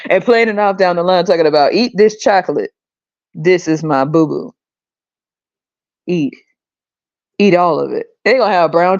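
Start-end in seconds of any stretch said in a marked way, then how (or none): 1.7-8.1 mock preacher. Mock preacher offering chocolate.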